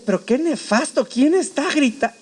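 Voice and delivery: mock annoyance. Survivor irritated by whoever is screaming, mock exasperation. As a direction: grumble upward at the racket.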